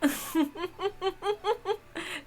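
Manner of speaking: mocking laugh. Laughing at paperweights.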